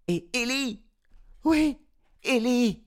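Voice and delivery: high, loud calling voice; woman calling across a crowd. Acted. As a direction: call her name over the crowd.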